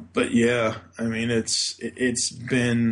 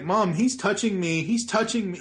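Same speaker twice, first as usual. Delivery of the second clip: high whiny tattle to mom; whiny tattling little sibling; 8 semitones higher than usual. Retold or imitated. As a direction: whine like a kid telling on a sibling. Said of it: "it's like".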